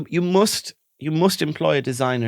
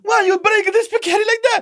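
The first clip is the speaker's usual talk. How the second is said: much higher, louder mock husband voice; mock Italian husband; 6 dB louder than usual. Mocking the Italian husband over broken spaghetti. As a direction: squeal it like an outraged Italian husband. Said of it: "her Italian husband's like".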